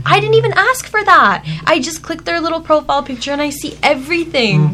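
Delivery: high-pitched, indignant disbelief; real shock. Real, not acted. Telling friends about the AI profile summary, shocked. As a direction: protest it in shocked disbelief, voice shooting up. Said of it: "the shock on his face".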